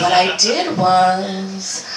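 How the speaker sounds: slow, teasing build-up; slow setup. Acted. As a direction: drag it out slowly before the punchline. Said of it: "comedic slow setup".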